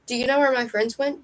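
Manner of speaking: ordinary delivery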